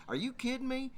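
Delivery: high-pitched disbelieving question; can't believe what you just heard; incredulous. Retold or imitated. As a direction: balk in disbelief, voice lifted high. Teller's disbelief at self-serve bar.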